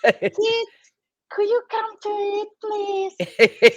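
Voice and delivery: put-on very high, sing-song mum calling kids; playing a role, sugary sweet and high; sweet modulated English voice. Shown as a demonstration. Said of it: "I sound more sweet".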